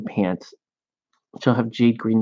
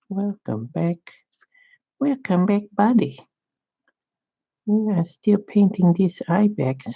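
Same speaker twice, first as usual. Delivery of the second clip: sing-song welcome; 7 semitones higher than usual. Pretend. Repeated greeting higher, sing-song.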